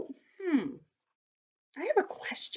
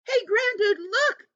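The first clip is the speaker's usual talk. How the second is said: high-pitched storybook alarm; alarmed yell; 12 semitones higher than usual. A narrator's reading. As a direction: call out urgently in a much higher voice. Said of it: "yelled Lucy".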